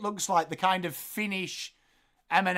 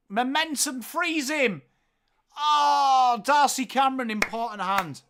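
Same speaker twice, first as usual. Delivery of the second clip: play-by-play call; 5 semitones higher, 4 dB louder than usual. Acted. Calls the play live.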